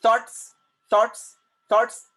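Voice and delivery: Thoughts in one beat, high and loud; single-syllable pronunciation. Shown as a demonstration. Teacher showing a single syllable word, one emphatic beat. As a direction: punch out thoughts with no vowel before the final s. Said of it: "It's a single syllable word".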